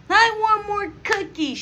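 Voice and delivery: put-on high, slow whining child; whiny kid voice. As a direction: whine it like a spoiled kid. Kid begging again.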